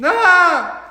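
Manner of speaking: very high loud scream; terrified scream. Acted. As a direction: scream as the blast hits. Techs scream.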